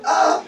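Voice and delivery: high mock-excited exclamation; imitated excited "ah". Preacher retelling her excitement, imitated high "ah". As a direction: copy her high little cry. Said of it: "She was very excited".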